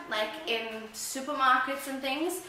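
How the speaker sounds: ordinary talk, nothing marked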